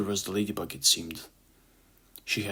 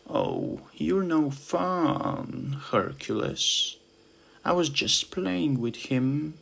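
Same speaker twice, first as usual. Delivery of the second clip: pouting ladybug; 7 semitones higher, much slower than usual. Acted. Dara pouting, drawn out.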